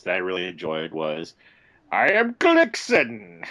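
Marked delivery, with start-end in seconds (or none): 1.9-3.4 high, slow, loud villain proclamation; grand mock villain announcement; booming villain line. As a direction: declaim it like a cartoon villain. Quoting a line from the book.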